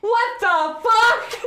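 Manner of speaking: high, slow crying wail; shocked wail. Real, not acted. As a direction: wail between laughs and tears. Can overflowing, crying laugh.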